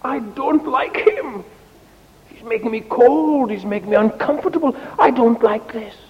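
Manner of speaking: high, whining grumble; complaining fire. Acted. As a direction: protest in a high voice at being blown on. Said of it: "it was complaining and saying".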